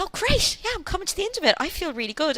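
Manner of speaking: high, eager inner voice; relieved excitement. Retold or imitated. Mother thinking labour is nearly over, high and eager. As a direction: sound thrilled it is nearly over. Her own thought quoted.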